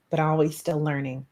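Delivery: normal talking voice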